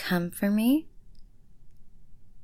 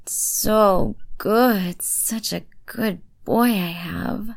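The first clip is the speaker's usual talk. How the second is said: excited praise; 2 semitones higher, 5 dB louder, slower than usual. Acted. Praising him as he finishes, loud excited praise. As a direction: let the praise burst out, then draw it out.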